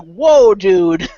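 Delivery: loud, mock-stunned exclamation; stunned reaction. Retelling a reaction to the actor's outburst, loudly stunned. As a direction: act out being taken aback.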